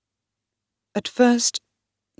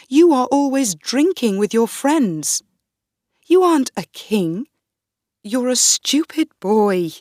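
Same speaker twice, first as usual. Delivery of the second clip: higher, louder contemptuous scolding; angry scolding; 5 semitones higher, 3 dB louder than usual. Acted. The queen scolding Darnley, angry and contemptuous. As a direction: tear into him with scorn.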